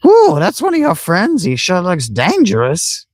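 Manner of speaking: high-pitched cartoon voice; game character. Character remarking on a dangerous friend, high voice. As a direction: pitch it way up, starting on a high ooh.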